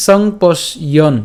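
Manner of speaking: "সংপশ্যন" slowly split, song-posh-yon; broken into syllables. Shown as a demonstration. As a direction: sound out "সংপশ্যন" piece by piece. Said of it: "এটাও আমরা একসাথে উচ্চারণ করবো". ("সংপশ্যন" ভেঙে ভেঙে ধীরে)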